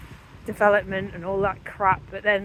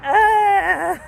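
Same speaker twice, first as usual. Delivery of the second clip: loud high yelp at the cold; shocked cry; 12 semitones higher, 10 dB louder than usual. Real, not acted. Reacts to freezing rain.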